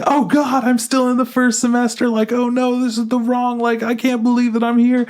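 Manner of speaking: high, panicked wail. Retold or imitated. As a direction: shriek in comic horror at being held back. Baka realizes she's held back.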